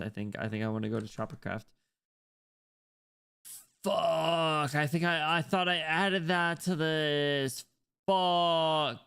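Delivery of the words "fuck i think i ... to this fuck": high, drawn-out frustrated yell; frustrated shout. Real, not acted. Streamer realising he forgot to add the mod, drawn-out frustrated shout. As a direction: wail it out in dismay.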